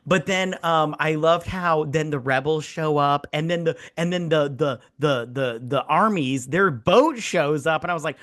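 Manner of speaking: ordinary talk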